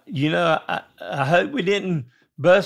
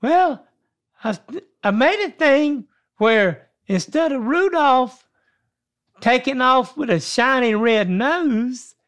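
elf voice; 4 semitones higher than usual. Acted. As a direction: keep the little elf voice up. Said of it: "you gotta have an elf voice".

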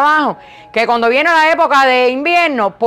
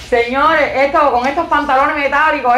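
ordinary delivery

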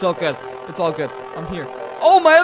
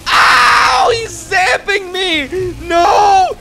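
high, loud frantic no-no-no shouting; panicked yelling; 5 semitones higher, 6 dB louder, slower than usual. Real, not acted. Gamer yelling while an enemy zaps him, panicked. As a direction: yell in real panic as you get hit. Getting zapped, weapon useless.

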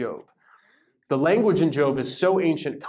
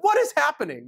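high-pitched, bewildered disbelief; mock alarm; 11 semitones higher than usual. Passenger in a tiny boat, baffled and alarmed.

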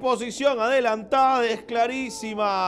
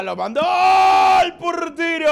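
excited shout; 10 semitones higher, 10 dB louder than usual. Real, not acted. Keeper save commentary.

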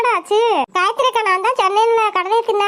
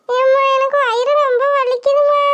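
put-on higher, whining child in pain; whimpering in pain; 3 semitones higher than usual.

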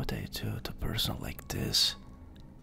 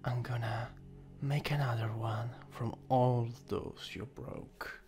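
ordinary delivery

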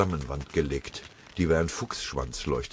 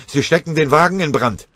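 laut und höher; schreiend; 9 semitones higher, 8 dB louder than usual. Acted. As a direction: warne panisch. Linsenmeier warnt schreiend.

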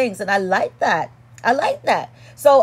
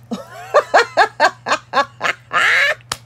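high burst of laughter; 10 semitones higher than usual. Real, not acted. Laughs at own karate boast.

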